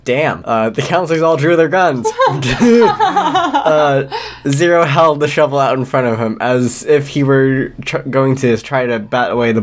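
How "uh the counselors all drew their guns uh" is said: a squealing high giggle; high burst of laughter. Real, not acted. Reader cracks up at the scene with a high giggle. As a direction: break into a high laugh mid-read.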